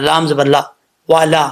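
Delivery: ordinary delivery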